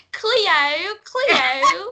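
loud, high, exaggerated accent on the name; mocking TV-show accent. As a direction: parody the show's accent, calling out. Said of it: "doing the same thing".

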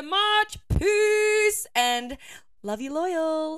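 loud, high, beaming goodbye; excited sign-off. Real, not acted. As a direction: send them off with a big happy shout.

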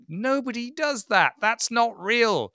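high, drawn-out protest; exasperated mock outrage; incredulous. Mocking unreal promo behaviour.

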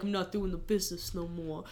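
lightly voiced, low and soft Ruben; made-up Ruben voice. Retelling Ruben quitting the business, lightly voiced low and soft. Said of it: "just how I've decided he sounds".

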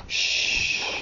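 long, soft shush; soft, drawn-out shh; hushing shh. Real, not acted. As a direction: hush the room for bedtime. Hushing children at bedtime.

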